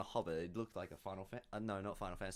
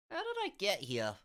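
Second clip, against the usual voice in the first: confused hobbit, pitched up at first; waking hobbit, confused; 8 semitones higher than usual. Acted. Hobbit wakes not knowing where he is, confused. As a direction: wake up baffled as the hobbit.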